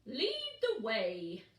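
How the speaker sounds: grand invitation, slow; big bear saying it slowly. Acted.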